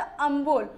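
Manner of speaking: humble with the h dropped. Shown as a demonstration. Teacher showing the old reading, humble without h. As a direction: say humble as "ambul". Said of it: "हम्बल को क्या पढ़ा जाता".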